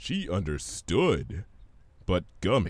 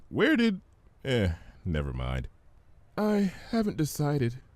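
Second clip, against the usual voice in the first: timid voice; 4 semitones higher than usual. Acted. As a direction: trail off shyly. Fluttershy timid.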